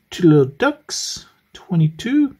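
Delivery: sing-song bingo call, pitch jumping up; bingo caller. Pretend. Scratching bingo numbers, calling them.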